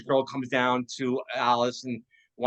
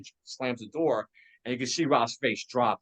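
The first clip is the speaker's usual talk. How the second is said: ordinary talk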